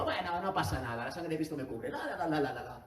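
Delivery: breezy, laughing mock nonchalance, tossing it off; lightly voiced careless sinner, chuckling through it; mock carefree shrug. Preacher mocking a believer who shrugs off sin, breezy and laughing.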